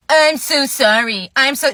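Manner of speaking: slow, loud parody of an apology; mocking mimicry. Customer mimics the employee's apology.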